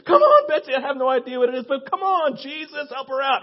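loud, high pleading cheer; worked-up fan pleading for help; frantic rooting. Retold or imitated. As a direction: urge on a struggling contestant. Retelling his rooting for contestant.

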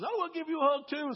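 high-pitched eager wife impression; imitated wife. Voicing Jason's wife offering hug.

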